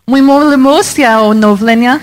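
whole phrase run together quickly; normal speed. Shown as a demonstration. Answering how fast the phrase is said normally. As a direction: rattle it off at native speed. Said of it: "How fast would that be normally".

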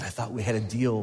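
mock hurt whisper leaned in close; a quiet, wounded reminder; hushed aside. Groom whispering to the pastor, hurt and quiet. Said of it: "he leaned up to the pastor and said".

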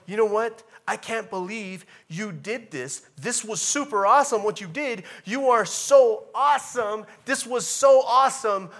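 loud, high, gushing thanks; excited gratitude. Acted. Preacher voicing a thrilled friend, loud and excited. Preacher acts out grateful response.

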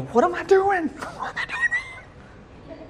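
put-on high, far-off squeaky child voice trailing into a laugh; whiny child. Child asking parents what he did wrong, squeaky and high. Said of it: "we go".

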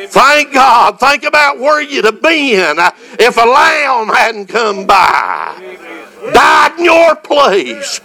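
preaching. Acted. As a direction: preach it out with full fire. Preacher building to full fervor.

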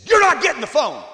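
loud high stern refusal. Retold or imitated. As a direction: put your foot down hard. Wife raising voice first time.

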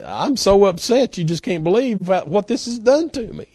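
put-on high whiny three-year-old; whiny toddler. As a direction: pout it out like a toddler. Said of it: "in his best little three-year-old whiny self".

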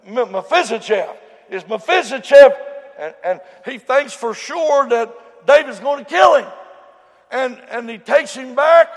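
loud, high, hammering preacher's cry; full-force preaching. Acted. Preacher telling the story at full force.

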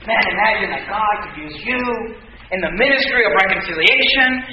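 imitating a gentle sermon, softened delivery; nice sermon. Preacher showing his congregation a nicer sermon, gently encouraging. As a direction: ease into a mild, encouraging preacher's tone. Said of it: "like, a nice sermon about".

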